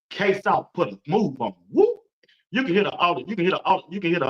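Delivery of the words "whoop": whoop sound effect. Retold or imitated. Describing a juke move.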